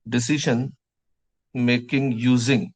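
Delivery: words stretched out slowly for note-taking; slow dictation. Shown as a demonstration. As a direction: spell it out slowly for the class to write. (धीरे-धीरे लिखवाना)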